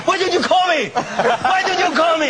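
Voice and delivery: high mock protest. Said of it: "I said".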